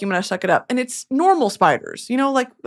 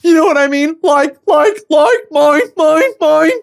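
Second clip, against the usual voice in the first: louder and higher sputtering; mock frustrated yelling; 6 semitones higher, 6 dB louder than usual. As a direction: sputter it out in overdone exasperation. Stammering loudly at her audience.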